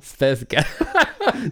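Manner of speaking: drawing it out while cracking up; American accent, laughing. Shown as a demonstration. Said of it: "in an American accent".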